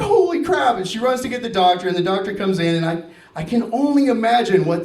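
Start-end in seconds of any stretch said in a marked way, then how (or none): 0.0-0.8 high, startled exclamation; retold shocked outburst; alarmed. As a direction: blurt it out in alarm. Retold alarmed reaction to an injury.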